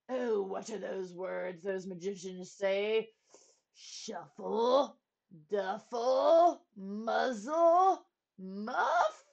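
puzzled royal voice, each guess lifting at the end; king groping for words. A narrator's reading. King recalling the magicians' words, puzzled. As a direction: try out each word uncertainly. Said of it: "groaned the king".

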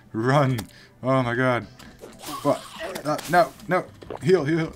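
panicked high yelling; frantic cries while fleeing; being chased. Real, not acted.

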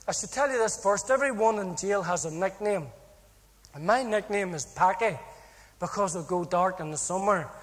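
usual speaking voice

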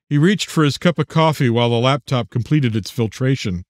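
plain reading voice

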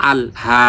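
আল হা with হা held long; short আল, then stretch out হা; elongated vowel. Shown as a demonstration. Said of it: "মাদের হরকত এক আলিফ".

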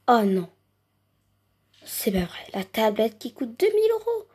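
ordinary talk

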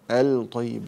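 الطيب with an audible l before the ط; wrongly pronounced lam. Shown as a demonstration. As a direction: say الطيب as al-tayyib, not merged. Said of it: "لا، لا أفعل هذا".

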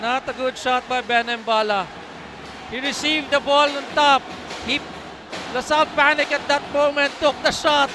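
ordinary delivery

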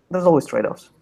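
ordinary talk

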